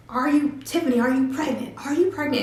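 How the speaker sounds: high, loud frantic questioning; mother screaming at her teenage daughter; mom screaming. Retold or imitated. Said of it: "she's saying".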